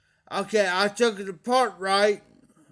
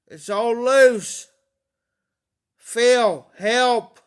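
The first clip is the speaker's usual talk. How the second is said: raised, slow, pleading call for help; loud plea; 6 dB louder, much slower than usual. Real, not acted. Pipe owner begging Phil for help, loud plea. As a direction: call out to Phil in real distress.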